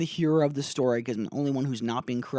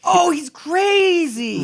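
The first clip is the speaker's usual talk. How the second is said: much higher, louder, very slow mock gasp; mock Frodo; 9 dB louder, much slower than usual. Riffing on Frodo realizing Gollum is bad, high slow ham. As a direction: stretch it out in a high, hammed-up squeal.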